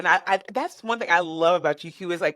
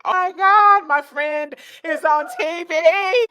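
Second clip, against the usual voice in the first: much higher, much louder delighted cry, slowing down; screaming with joy like a proud parent; overjoyed shriek; 10 semitones higher, 7 dB louder than usual. Retold or imitated. Seeing her friend on TV, an overjoyed high shriek. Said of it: "I was so happy".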